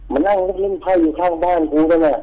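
agitated insisting. Retold or imitated. Uncle arguing, pointing across.

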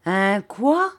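incredulous question, pitch climbing; indignant cry. A narrator's reading. Pupil affronted by an insult, incredulous cry. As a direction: demand to know what was said, affronted. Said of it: "cria Steerforth".

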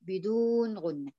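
"بدون غنة" said slowly, each word weighted; drawn-out "بدون غنة" for the students; slow emphasis. Shown as a demonstration. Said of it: "بدون إيه؟". (نطق "بدون غنة" ببطء وتأكيد)

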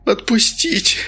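breathless whispered plea; barely voiced whimper; whimpered mumble. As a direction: mumble it, spent and scared. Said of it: "промямлил Денис".

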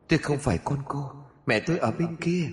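put-on high, soft, eerie child voice; a cold little ghost voice; demon child. Demon baby rejecting its mother, high, soft and eerie.

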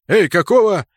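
indignant shout. A narrator's reading. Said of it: "возмущённо повернулся".

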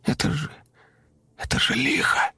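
frightened stammering whisper; trembling whisper. Acted. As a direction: whisper it in terror. Terrified boy whispers.

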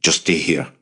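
restrained firm instruction; mild insistence. A narrator's reading. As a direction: insist without raising the voice. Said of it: "Avi insisted".

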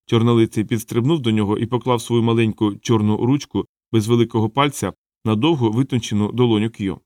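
ordinary delivery

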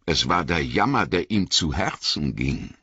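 plain reading voice

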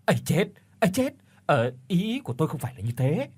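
quick, high, flustered protest; flustered panic. A narrator's reading. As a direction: blurt it out in alarm. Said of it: "đã hốt hoảng nói". (hốt hoảng vội vàng thanh minh, giọng cao)

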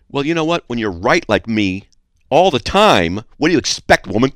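hammed-up smug superiority; arrogant and condescending. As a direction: talk down to her as a joke. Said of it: "I was doing the arrogant, condescending thing".

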